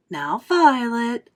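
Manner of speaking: slow, drawn-out caution; slow warning mother. Acted. Mother warning Violet, slow and cautious. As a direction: hold her back gently.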